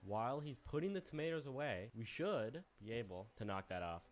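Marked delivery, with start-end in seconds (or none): none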